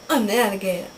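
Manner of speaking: ordinary delivery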